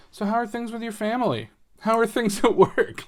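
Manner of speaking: high, bright small-talk voice, chuckling; chatty friend voice. Retold or imitated. Friend chatting over Tetris, asking about family, high and chatty. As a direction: lightly play a friend opening up over Tetris. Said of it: "you're just like".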